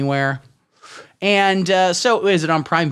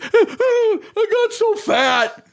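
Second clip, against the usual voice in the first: much higher, drawn-out wailing mock lament; mock tragic lament; much slower than usual. Mocking the lead of The Whale with a whiny, dragged-out wail. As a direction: stretch it into a whiny over-the-top moan. Mocking Brendan Fraser's character.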